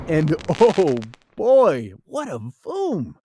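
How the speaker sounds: usual speaking voice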